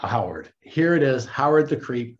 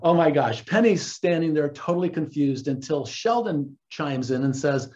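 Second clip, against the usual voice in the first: ordinary talk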